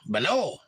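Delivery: Bano as buh-NO; second-syllable stress. Shown as a demonstration. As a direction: lean on the end of Bano. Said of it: "I'm going baño".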